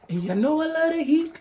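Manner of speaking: high, loud playful squeal of delight; excited about heat. Acted. Cook to viewers, squealing with delight. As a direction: belt it out, thrilled about spice. Hyping up the red pepper.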